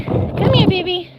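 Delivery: high sweet call to a pet. Real, not acted. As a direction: coax the dog in a cooing voice. Calling the dog sweetly.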